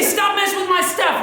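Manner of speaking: high, mock-exasperated demand; put-on irritation, pitched well up; annoyed sibling. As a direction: whine at a pesky little sibling. Acts out annoyed older sibling.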